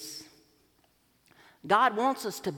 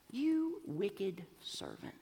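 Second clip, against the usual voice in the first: stern whisper; 8 dB quieter, much slower than usual. Acted. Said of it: "and he said".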